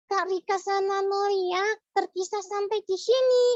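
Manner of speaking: squeaky puppet, singsong verse; high puppet voice reciting a rhyme. Acted.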